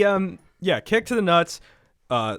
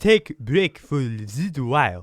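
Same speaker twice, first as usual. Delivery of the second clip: put-on Japanese-accented English, louder; lightly mock Japanese accent; 4 dB louder than usual. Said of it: "That's how he talks".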